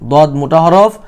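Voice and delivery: ordinary talk, nothing marked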